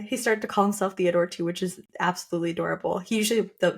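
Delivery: ordinary talk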